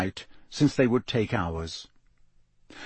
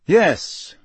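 higher, louder firm yes; almost defiant; 8 semitones higher, 6 dB louder than usual. Acted. Harry answers Moody almost defiantly. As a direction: stand your ground. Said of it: "almost defiantly".

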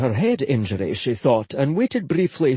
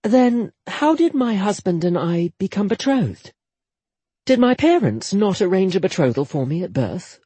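higher, earnest lady asking; inquisitive young woman; 5 semitones higher than usual. Acted. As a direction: probe gently with questions. Jetta questions Akir.

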